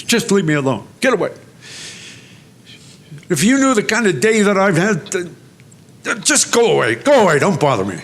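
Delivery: a woman near tears telling him to go away; tearful upset plea. Retold or imitated. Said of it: "And she started to cry".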